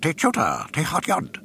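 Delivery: high alien gatekeeper voice; talking eyeball. Acted. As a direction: bark it like a strange door guard. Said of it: "The eyeball spoke".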